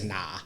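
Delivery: NAH said low and soft for no; child's nah. Retold or imitated. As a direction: imitate a kid's offhand "Nah". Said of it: "He don't even say no".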